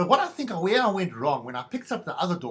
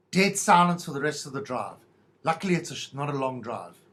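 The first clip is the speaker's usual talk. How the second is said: ordinary talk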